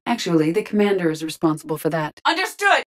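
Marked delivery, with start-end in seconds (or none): 2.2-2.9 high-pitched combat reply; urgent acknowledgement. Acted. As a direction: answer briskly over battle noise.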